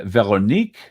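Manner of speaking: mock French Veronique; a light stab at a French accent; French-sounding name. Shown as a demonstration. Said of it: "Trying to say it sound French".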